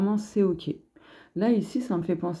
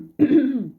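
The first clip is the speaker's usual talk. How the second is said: sudden loud throat clearing; 7 semitones higher, 8 dB louder than usual. Real, not acted. Reader with something stuck in her throat, clearing it loudly.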